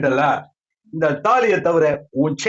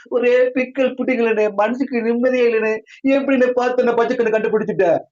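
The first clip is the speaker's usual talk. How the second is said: whining complaint; 5 semitones higher than usual. Acted. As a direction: wail like a henpecked husband. Henpecked friend wails about wife.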